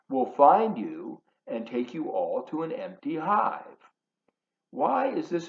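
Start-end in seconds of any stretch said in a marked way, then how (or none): none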